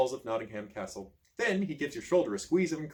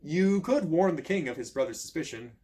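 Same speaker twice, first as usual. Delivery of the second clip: ordinary delivery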